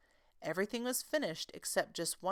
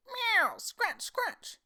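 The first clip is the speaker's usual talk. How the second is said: high meow, then scratchy cat voice; 4 dB louder, much slower than usual. A narrator's reading. Simpkin scratching at the shop window, high meow. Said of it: "scuffled Simpkin on the windowsill".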